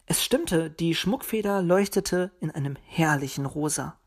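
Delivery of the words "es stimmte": ordinary delivery, nothing marked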